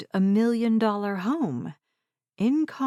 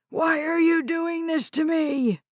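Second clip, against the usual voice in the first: screaming; 8 semitones higher than usual. Acted. Old man screaming at his granddaughter. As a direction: scream it at her in despair. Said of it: "Red screamed".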